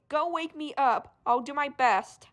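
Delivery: ordinary talk, nothing marked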